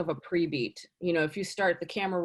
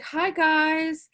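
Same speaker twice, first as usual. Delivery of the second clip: high, slow, overly bubbly self-introduction; mock a too-cheery actor greeting the camera; hammed-up perky slate intro; chirpy actor slate; 9 semitones higher, 3 dB louder, slower than usual. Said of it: "You don't have to be like".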